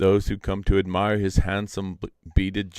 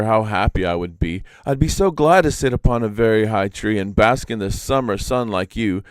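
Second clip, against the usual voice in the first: louder, higher yearning spider imp; louder longing Iktomi; 3 semitones higher, 8 dB louder than usual. Acted. Iktomi begs the peacock for its feathers, louder and yearning. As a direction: ache for those feathers, fuller voice. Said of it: "retake after long pause".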